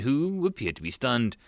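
usual speaking voice

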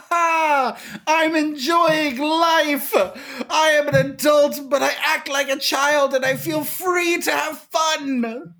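high, laughing, shouted with joy; giddy childish glee. Acted. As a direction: squeal like a kid at play. Grown man playing like child.

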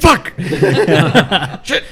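high, loud laughing curse; laughing outburst. Real, not acted. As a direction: blurt it out mid-laugh. Laughing at the slip.

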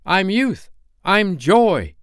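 put-on high, exultant boy's voice; Peter. A narrator's reading. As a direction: proclaim it in a high ringing voice. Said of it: "Peter answered at a venture".

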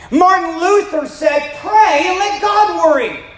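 preaching at full force. Acted. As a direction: lift the room with rising conviction. Preaching climax.

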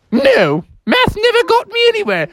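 high, dismissive teacher goose; Mother Goose. Acted. Teacher rejects the mouse's answer, high indignant goose. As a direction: scold in a high falsetto. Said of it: "And Goosey was like".

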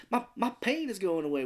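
high childlike imitation; naive, childish quote in a high voice; childlike dying man. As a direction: imitate an innocent little kid, pitched high. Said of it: "He's acting like a little kid".

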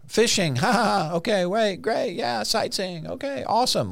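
high-pitched mock carefree chatter; snarky.

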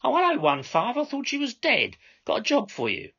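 high, loud mocking greeting; theatrical dispatcher. Controller to driver one five, theatrical tease. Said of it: "raised her eyes theatrically and responded".

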